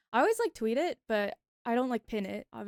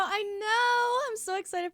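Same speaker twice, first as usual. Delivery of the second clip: excited; 9 semitones higher, 4 dB louder, slower than usual. Real, not acted. Excited about Dan Day.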